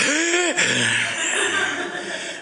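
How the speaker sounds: a sharp, high, laughing gasp for air; breathless gasp. Retold or imitated. Grandpa winded from the carrying, gasping with a laugh. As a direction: gasp like you're winded but amused.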